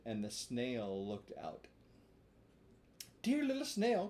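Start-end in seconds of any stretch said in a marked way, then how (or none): 3.2-4.1 put-on high cry of a wooden boy. A narrator's reading. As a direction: call up to the window pleading. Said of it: "cried Pinocchio".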